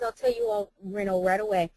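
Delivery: normal talking voice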